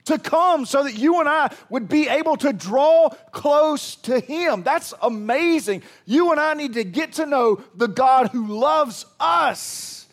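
high, loud preacher's build; fiery preaching. Acted. Pastor rousing the congregation, fiery preaching. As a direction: preach it with full fire.

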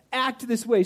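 ordinary delivery

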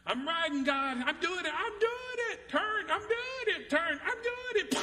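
put-on high, excited little kid on a bike; excited child. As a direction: squeal like a kid wobbling on a bike. Child learning to ride bike.

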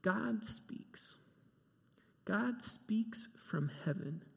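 whispered, slow and reverent; hushed whisper. Real, not acted. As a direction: breathe it in awe. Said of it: "hushed reverence".

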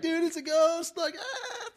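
high, loud panicked shriek; hammed-up fright at a ghost; mock scared yell. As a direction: disciples shrieking in fear on the boat, high and loud. Terrified disciples cry out.

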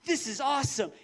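bright, eager "yeah, this is awesome"; cheering like a preacher; mock excitement. Excited preacher loving his sermon topic. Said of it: "you're like".

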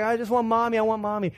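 high mock child's whine; imitated screaming toddler. Dad imitating daughter demanding mommy, high tantrum whine. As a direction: whine insistently in a small child's pitch. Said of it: "she's, like, screaming".

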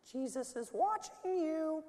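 high slow parrot voice; slow eerie bird voice. Acted. Parrot voice again.

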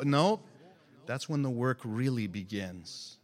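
usual speaking voice

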